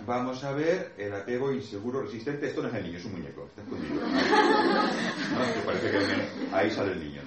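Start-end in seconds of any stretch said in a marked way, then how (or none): 4.0-5.4 laughing. Real, not acted. Laughing at the hidden doll.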